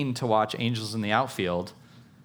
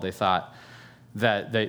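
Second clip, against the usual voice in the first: ordinary talk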